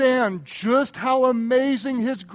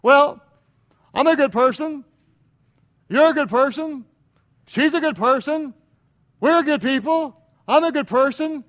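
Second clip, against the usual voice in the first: higher-pitched mock voice of popular opinion; self-satisfied believer; 6 semitones higher, 6 dB louder than usual. Preacher voicing the cultural lie, higher-pitched mock self-assurance. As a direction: lightly parody people reassuring themselves. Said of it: "one of the biggest cultural lies that people believe today is this".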